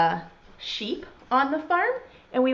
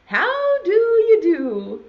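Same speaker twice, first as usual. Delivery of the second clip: high, loud, slow singsong greeting; horse's greeting; 9 semitones higher, 6 dB louder, slower than usual. Acted. Horse greeting the rooster in a poem, singsong. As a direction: stretch it out in a high storybook voice. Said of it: "said the horse".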